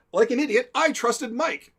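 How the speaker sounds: high, loud, mock indignation; imitating aggrieved friend. [name] recalling the prank, imitated indignant complaint. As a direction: recount it as the duped victim. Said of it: "as [name] has recalled this story".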